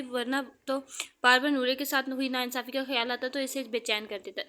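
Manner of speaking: ordinary delivery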